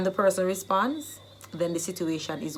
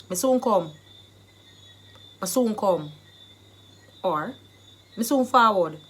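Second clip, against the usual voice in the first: loud Jamaican patois; 5 dB louder than usual. Shown as a demonstration. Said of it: "the Jamaican dialect phrase".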